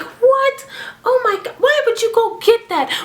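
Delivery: very high, hammed-up dismay; shocked aunt. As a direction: imitate an aunt scolding in shrill disbelief. Aunt's shocked scolding acted out.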